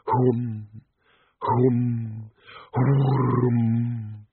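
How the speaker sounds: repeated droning hums, last one long; steady imitated drone. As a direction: hum like a whirled bull roarer. Bull roarer noise imitated.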